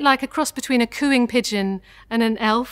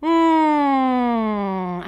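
hummed mm. Shown as a demonstration. Voice coach hums mm for students. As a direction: hum the mm lightly. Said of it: "make that sound for me".

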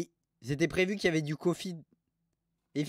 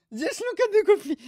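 imitating an angry English reviewer, much higher; angry English tourist; 6 dB louder than usual. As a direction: squeal it like an outraged Brit tourist. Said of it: "Il est de retour".

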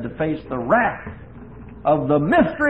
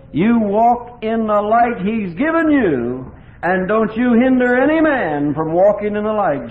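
preaching climax; 7 semitones higher, 7 dB louder than usual. Real, not acted. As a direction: drive it home to the congregation. Preacher exhorting congregation.

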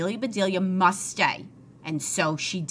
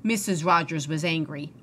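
ordinary delivery

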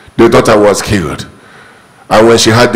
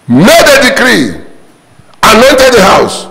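triumphant proclamation; 10 semitones higher than usual. Real, not acted. Preacher boasting to church of decree, triumphant and high. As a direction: proclaim it like a victory.

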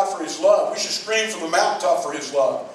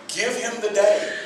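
ordinary talk

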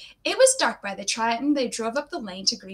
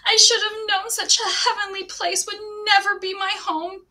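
much higher lament; wailing; 8 semitones higher, 4 dB louder than usual. A narrator's reading. Heartbroken girl mourning the lost home, wailing. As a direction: mourn it dramatically. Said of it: "Anne wailed".

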